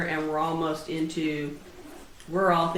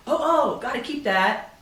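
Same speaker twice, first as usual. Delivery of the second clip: a touch of put-on alarm, pitched up; mock-alarmed client; 5 semitones higher than usual. Organizer imitating clients asked about their holiday cards, mock alarm. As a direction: lightly mimic a protesting client, higher.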